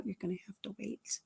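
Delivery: very soft, patient reminder; patient refrain. Retold or imitated. Grandmother answering granddaughter at the pier, soft and patient. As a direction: answer the child very quietly.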